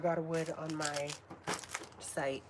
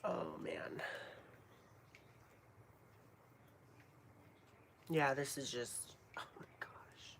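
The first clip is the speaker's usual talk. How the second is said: soft unvoiced whisper of awe; awed whisper; 3 semitones lower, 9 dB quieter than usual. Real, not acted. Vlogger smelling incense, hushed awed whisper. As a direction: sigh it out, overwhelmed.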